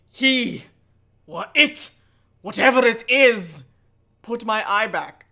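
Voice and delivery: halting horror narration; story narration. A narrator's reading. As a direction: pause between fragments for suspense. Reads the horror book aloud.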